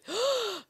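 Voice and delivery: big, loud intake of breath; shocked gasp. Pretend. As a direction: gasp in theatrical surprise. Mock shock at noise complaint.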